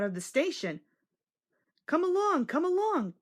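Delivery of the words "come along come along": much higher eager chant; chugging engine's brisk call; puffing. A narrator's reading. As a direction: urge them on, puffing along. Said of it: "puffed Thomas".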